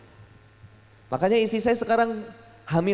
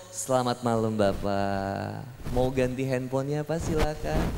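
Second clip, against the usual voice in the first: low, soft, drawn-out salesgirl greeting; SPG toko ponsel; 9 semitones lower, 6 dB quieter, slower than usual. Acted. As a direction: lay on a soft, coaxing sales pitch. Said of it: "Kata S P G Oppo". (suara lembut SPG merayu)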